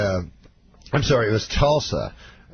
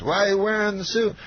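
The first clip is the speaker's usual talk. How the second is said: Bill Cosby; 9 semitones higher than usual. Retold or imitated. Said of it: "and he says".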